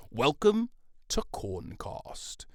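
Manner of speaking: slow, drawn-out announcer welcome; mock podcast intro. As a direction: announce it grandly, stretching every word.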